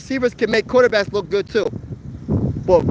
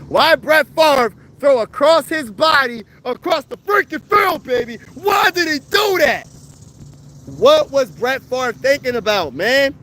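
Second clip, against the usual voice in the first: high, incredulous outcry rising to a shout; disbelieving yell at Favre's throw; exasperated rant; 5 semitones higher than usual. Real, not acted. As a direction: vent at the blown playoff game. Ranting over Favre's 2009 interception.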